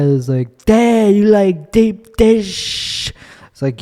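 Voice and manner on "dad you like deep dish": high, slow, mocking Chicago accent. Host making fun of the comic, mocking exaggerated Chicago accent. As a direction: tease with a stretched, overdone Chicago accent. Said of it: "Tony just kept making fun of me".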